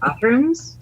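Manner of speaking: mock-innocent lilt; facetious. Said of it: "I got even more facetious".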